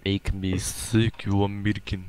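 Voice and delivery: very slow mock Russian accent. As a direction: stretch each word in a fake Russian drawl. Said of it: "you're using your Russian accent".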